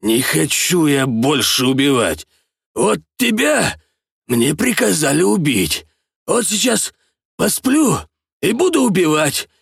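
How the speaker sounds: put-on drunk, slurred, high and halting; slurred speech. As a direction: slur each word, drifting toward sleep. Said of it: "заплетающимся языком".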